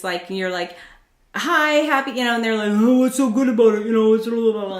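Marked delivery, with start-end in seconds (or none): 1.4-2.1 high, loud mock-happy hello; acted happy hello, pitched up; happy greeting. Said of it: "you're all happy". 2.7-4.8 loud mock grumbling; hammed-up moan, running into blah-blah; grouchy customer. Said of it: "somebody comes into your world".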